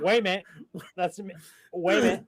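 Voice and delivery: high, loud double take; surprised. Real, not acted. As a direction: catch yourself mid-sentence, startled. Host reacts to a paused frame.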